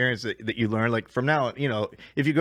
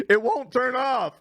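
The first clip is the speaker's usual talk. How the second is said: higher, slow yelled reply; mock yell back; 9 semitones higher, slower than usual. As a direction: shout it back across the room like a flustered bartender. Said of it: "he yells back at me".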